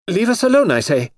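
high, loud imperious command; angry. Acted. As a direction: stamp it out like a spoiled princess. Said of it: "She stamped her foot angrily".

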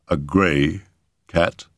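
measured, deliberate query; slow question. A narrator's reading. Doctor questioning the footman about the cat, slowly. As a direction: ask it slowly, word by word. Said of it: "I asked slowly".

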